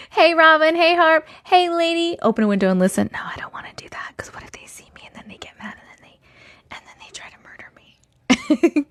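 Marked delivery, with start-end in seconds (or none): 3.1-8.9 hushed, fearful, breathless whisper; mock-terrified whisper about being seen; scared whisper. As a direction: whisper it like they might hear you. Fearful reply to "open a window.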